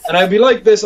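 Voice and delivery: ordinary talk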